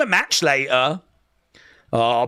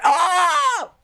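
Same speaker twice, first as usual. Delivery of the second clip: much higher delighted mock child gasp; mock kid gasp; 10 semitones higher than usual. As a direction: gasp like a kid spotting himself on screen. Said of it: "he was like".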